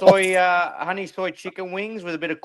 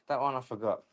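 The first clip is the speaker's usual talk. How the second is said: softer repeat; 5 semitones lower, 10 dB quieter than usual. Real, not acted. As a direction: repeat it much softer and lower, almost to himself. Repeats the line, second take softer.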